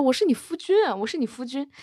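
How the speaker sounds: high-pitched, hammed-up indignation; mock exasperation. Dragon insisting to his forgetful husband, mock-indignant. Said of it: "景飞荣气疯了".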